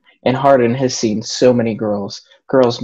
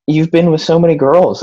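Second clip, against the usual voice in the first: mildly blurted, a little higher; 5 semitones higher than usual. A narrator's reading. Narrator reads Tess blurting to Hardin, blurted out. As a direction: let it slip out, higher-pitched. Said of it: "I blurt out".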